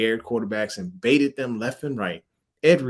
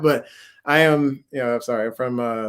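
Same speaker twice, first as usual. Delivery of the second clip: ordinary talk, nothing marked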